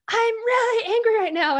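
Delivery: loud, high reenacted outburst; angry yell. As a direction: blurt the anger out forcefully. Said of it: "But so I just said".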